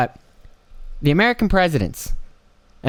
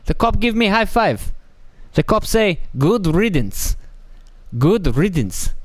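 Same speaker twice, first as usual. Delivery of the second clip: higher-pitched mocking caricature of an intolerant foreigner; mock foreign hardliner; 7 semitones higher than usual. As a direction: lampoon a bigoted official from abroad in a raised voice. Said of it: "Most other countries, it's like".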